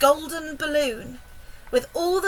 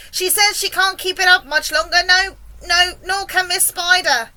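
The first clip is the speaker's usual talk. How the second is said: loud, higher-pitched report; yelled; 5 semitones higher, 6 dB louder than usual. A narrator's reading. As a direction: yell the bad news up from below. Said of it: "yelled the centipede from below".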